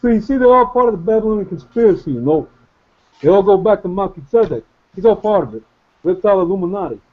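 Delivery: put-on half-hearted Rocky Balboa accent; mock Brooklyn Italian tough guy; poor Rocky impression. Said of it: "a really poor version of Rocky".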